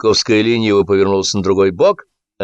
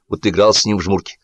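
usual speaking voice

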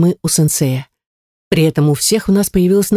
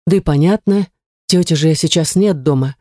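usual speaking voice

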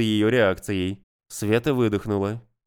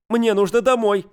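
panicked; 11 semitones higher, 5 dB louder than usual. Acted. Panicked girl wants home.

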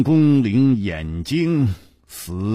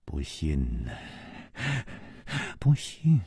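much softer, low repeated mutter; murmuring; 3 semitones lower, 9 dB quieter than usual. A narrator's reading. Dying man mutters over and over, softly. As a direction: keep mumbling as if not hearing. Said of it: "不住地喃喃地说".

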